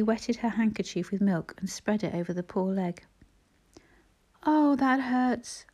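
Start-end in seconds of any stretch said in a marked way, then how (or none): 4.4-5.7 high, drawn-out complaint; slow, higher-pitched acted wince; pained cry. A narrator's reading. Injured boy shrinking as milk touches his leg, pained cry. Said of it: "cried the boy, shrinking".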